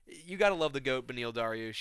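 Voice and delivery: ordinary delivery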